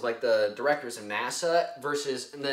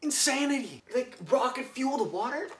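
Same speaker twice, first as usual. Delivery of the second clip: high-pitched disbelief; astonished squeak rising at the end; incredulous; 9 semitones higher than usual. Real, not acted. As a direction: marvel at it, pitched way up into a question. Marvels at the hero's plan.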